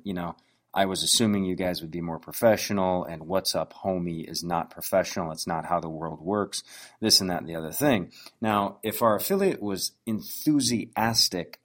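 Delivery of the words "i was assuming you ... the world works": ordinary talk, nothing marked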